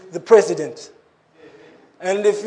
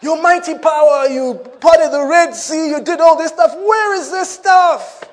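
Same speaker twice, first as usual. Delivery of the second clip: rising higher, pleading and exasperated; Gideon pleading; 7 semitones higher, 5 dB louder than usual. Retold or imitated. Gideon to the angel, a rising exasperated plea. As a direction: press harder and higher toward the last question. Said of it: "And Gideon says".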